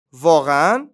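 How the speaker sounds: loud rising query in normal pitch; duck's question. A narrator's reading. Duck replying to mouse, questioning duck voice. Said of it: "اردکه گفت".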